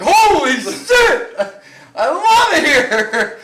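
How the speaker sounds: very loud, high, stretched-out whoop; over-the-top ecstatic yell; delighted shout. Retold or imitated. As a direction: re-enact being thrilled, yelling it slowly. Acts out his delighted reaction.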